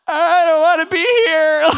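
loud, high-pitched wail of dread; panicked wail. Retold or imitated. Young missionary stuck in a stranger's home, panicked wail. Retelling his panic at being made to drink.

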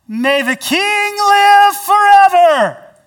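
high, loud, slow proclamation; called out loudly. Acted. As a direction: call up from the pit with full voice. Daniel calling from den.

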